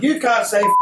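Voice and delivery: high-pitched mock objection. Acting out girls objecting to swearing.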